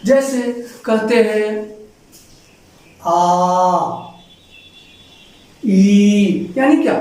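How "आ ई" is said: आ and ई drawn out long; long vowel demo. Shown as a demonstration. Teacher showing long vowels to pupils, drawn out. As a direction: stretch आ and ई to double length. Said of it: "दुगुना समय लग जाता है".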